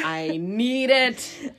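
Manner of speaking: very slow, longing; slow craving. Retold or imitated. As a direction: stretch it out with craving. Yura craving hair.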